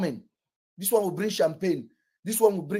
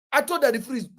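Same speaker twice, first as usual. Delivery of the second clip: normal talking voice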